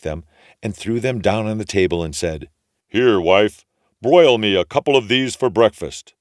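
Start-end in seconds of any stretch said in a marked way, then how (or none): 2.9-6.1 loud booming ogre voice. A narrator's reading. Ogre ordering his wife to cook, booming. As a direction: order the wife about like a giant.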